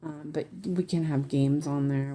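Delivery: ordinary talk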